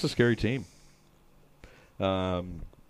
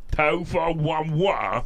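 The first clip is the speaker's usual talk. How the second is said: put-on cockney accent; 5 semitones higher, 4 dB louder, much slower than usual. Cockney bit, laughing.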